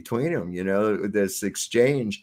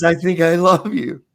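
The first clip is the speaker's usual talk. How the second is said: higher and louder, gushing; delighted declaration; 6 semitones higher, 7 dB louder than usual. Retold or imitated. Villain gushing at the man, higher and louder. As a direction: relish the creepy affection.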